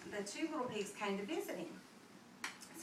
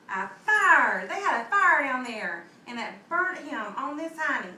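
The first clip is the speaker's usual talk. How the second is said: much louder, excited telling; excited narration; 3 semitones higher, 12 dB louder than usual. A narrator's reading. Narrator telling the child the wolf got burnt, louder. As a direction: build the drama of the fire.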